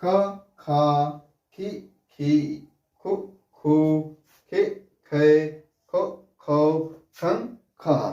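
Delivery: ख, खा, खी, खु drawn out, one sound at a time; slow syllable drill. Shown as a demonstration. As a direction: read the ख series slowly as a model for children. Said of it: "तो इसको हम लोग पढ़ेंगे कैसे?". (ख की बारहखड़ी धीरे-धीरे पढ़ना)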